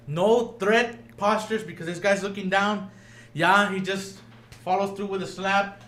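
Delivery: ordinary talk, nothing marked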